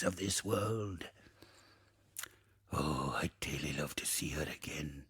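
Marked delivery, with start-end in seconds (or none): 2.7-5.0 soft low whisper of longing; wistful whisper. Acted. Ghost misses his granddaughter, wistful whisper. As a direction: whisper it as a private wish.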